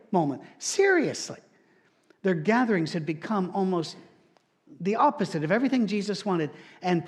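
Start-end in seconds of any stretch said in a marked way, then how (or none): 0.6-1.4 high-pitched seriously; incredulous seriously. Real, not acted. Pastor insisting the Corinth church was absurd, high incredulous. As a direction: sell the absurdity.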